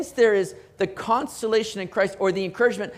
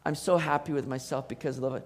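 a hint of mock self-pleased sigh, much softer and lower; smug self-satisfaction; 5 semitones lower, 8 dB quieter than usual. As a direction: lightly play someone pleased with themselves. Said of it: "be like".

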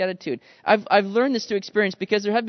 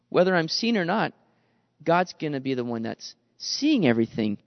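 ordinary talk, nothing marked

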